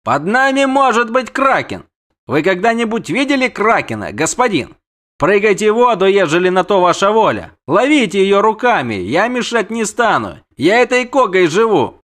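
high, loud protesting captain; defiant captain. Acted. Captain refuses to risk his ship, defiant.

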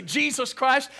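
mock exclamation. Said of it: "We will say".

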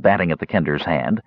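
plain reading voice

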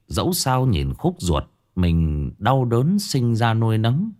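usual speaking voice

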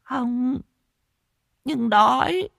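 very high, plaintive little voice; high-pitched pleading beggar; hungry mad girl. A narrator's reading. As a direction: answer simply in a high, childlike whine.

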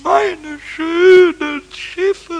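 slow, loud wail over his ships; drawn-out mournful cry; lament. Acted. Goblin mourning his crumpled ship drawings, slow wail.

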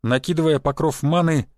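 ordinary delivery

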